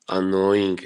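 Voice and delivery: ordinary delivery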